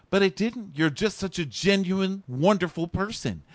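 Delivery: high earnest mock praise; mock sappy host. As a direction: gush it in a lightly high voice. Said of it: "He's like".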